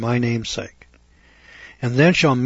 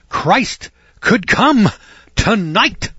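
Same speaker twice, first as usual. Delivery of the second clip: high, slow, swelling preacher voice; Billy Graham; 8 semitones higher, 6 dB louder, much slower than usual. Retold or imitated. Preacher quoting Billy Graham to his congregation, high and slow. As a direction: stretch it out like a grand revival preacher. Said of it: "Billy Graham said".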